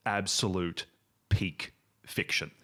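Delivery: ordinary delivery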